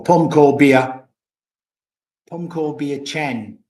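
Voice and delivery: slow careful Thai "Pom ko bia"; Thai phrase. Shown as a demonstration. Vlogger showing off the Thai he learned, slow and careful. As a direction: spell out "pom ko bia chan" slowly in Thai tones. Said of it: "I had to learn that one".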